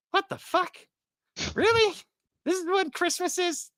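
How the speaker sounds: put-on high-pitched outraged little-kid voice. The youngest son catches his parents doing Christmas, high outraged kid voice. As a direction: squeak it like a betrayed seven-year-old.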